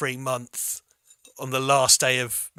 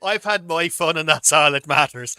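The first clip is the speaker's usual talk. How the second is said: robot voice; 8 dB louder than usual. Pretend. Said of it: "nineteen fifties robot".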